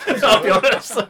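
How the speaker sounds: loud laugh through the words; laughing it off, loud and high. Real, not acted. Laughs at own anger.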